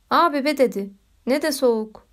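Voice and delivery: ordinary delivery, nothing marked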